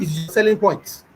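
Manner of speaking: usual speaking voice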